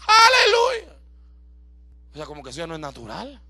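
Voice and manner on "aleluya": high, loud hallelujah shout; mock joyful shout. Shown as a demonstration. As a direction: cry out praise even when it goes badly. Alabar a Dios aun cuando va mal. (grito de júbilo fingido)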